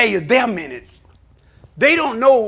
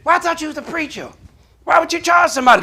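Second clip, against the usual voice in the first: mock indignant caller; 6 semitones higher, faster than usual. Caller questioning a paid preacher.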